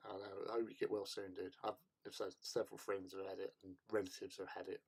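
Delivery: low, soft, hushed well-wishing; ASMR voice. Pretend. Streamer wishing a sick viewer well, ASMR voice. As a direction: keep it very quiet and soothing. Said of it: "A M S R".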